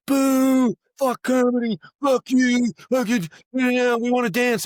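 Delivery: high, loud mock booing; jeering crowd. Dancers booing the comedy show, loud mock jeering. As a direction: imitate a hostile mob's drawn-out shouts.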